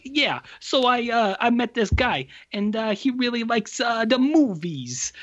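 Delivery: high, slow mock Brooklyn accent. Actress telling her therapist about a man, imitated Brooklyn accent. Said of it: "Brooklyn accent".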